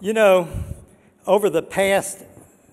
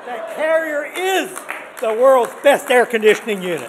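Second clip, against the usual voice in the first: excited; 4 semitones higher, 7 dB louder than usual. Real, not acted. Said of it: "I get excited".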